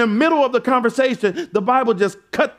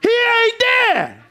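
high, shouted reveal, slowing on the end; playful preacher proclaiming the empty tomb; mock big Easter announcement; 12 semitones higher, slower than usual. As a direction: ham up the pastor's Easter punchline. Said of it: "Pastor gotta get up here and say".

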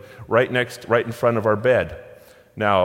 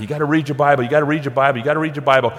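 insistent nagging; 4 semitones higher, 4 dB louder, much faster than usual. Retold or imitated. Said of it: "You hear us many times say".

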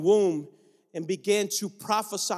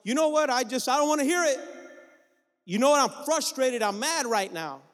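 frustrated wife; 6 semitones higher than usual. Retold or imitated. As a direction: snap like a fed-up wife, then settle. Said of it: "And then there comes the wife".

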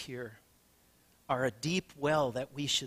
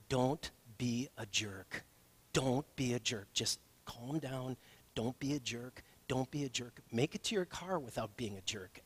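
muttering to himself to stay calm; inner voice; 3 semitones lower, 7 dB quieter than usual. Retold or imitated. Pastor calming himself at the service station, hushed. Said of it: "and I'm thinking".

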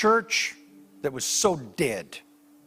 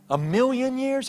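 high, rising disbelieving guess; astonished question; 10 semitones higher than usual. Retold or imitated. Pastor asking the angel its age, astonished. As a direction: ask it in amazement. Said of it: "so my pastor said to him".

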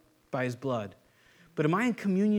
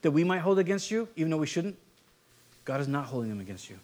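usual speaking voice

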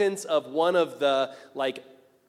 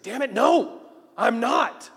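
high, loud acted outburst; angry cursing; 7 semitones higher, 8 dB louder, slower than usual. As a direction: curse and deny it furiously. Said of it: "Peter gets angry and he curses".

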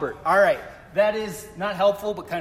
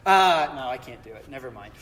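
usual speaking voice

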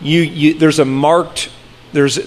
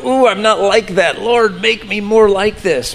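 higher, earnest little plea to the Lord; a pleading prayer, pitched up; self-quoted prayer; 5 semitones higher than usual. Retold or imitated. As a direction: catch yourself and pray for change. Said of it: "the more I go".

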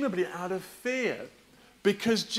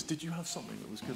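hushed; 3 semitones lower, 12 dB quieter, much faster than usual. Shown as a demonstration. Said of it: "Do you want to whisper it?".

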